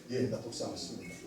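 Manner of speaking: low, soft confession; acted humble admission. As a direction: own up quietly and meekly.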